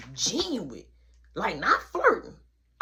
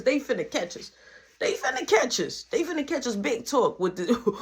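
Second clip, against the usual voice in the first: usual speaking voice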